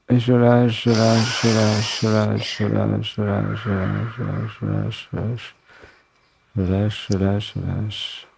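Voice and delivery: repeated mantra fading to near silence. Acted. Breathing release mantra.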